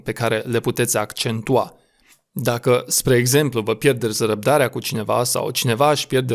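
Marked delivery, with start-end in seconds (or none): none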